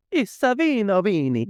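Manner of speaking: high-pitched mock Italian correction; playful imitation of an Italian wife; Italian wife correcting. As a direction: squeal it like an excited Italian wife. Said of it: "She says".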